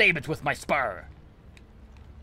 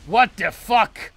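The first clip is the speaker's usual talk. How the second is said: sudden loud slow startled cry; 10 semitones higher, 9 dB louder, slower than usual. Real, not acted. Gamer startled mid-boast, loud shocked cry. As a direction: caught off guard, blurt it out loud.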